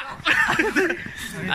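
a high-pitched burst of laughter; high laughing. Real, not acted. Friend laughing high at the story joke.

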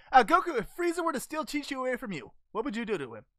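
ordinary talk

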